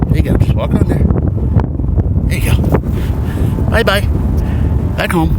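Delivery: high coaxing voice to a crab. Real, not acted. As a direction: sweet-talk a little critter.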